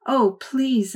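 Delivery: put-on a girl wailing at her family to spare the fish; wailing plea. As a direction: beg like a heartbroken child. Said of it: "I wailed".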